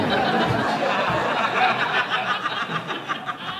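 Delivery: high burst of laughter. Real, not acted. Host laughs at story.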